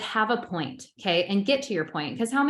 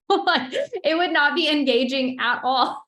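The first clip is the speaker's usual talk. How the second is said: laughing; 7 semitones higher, 5 dB louder than usual. Real, not acted. Laughing at own camera-off idea.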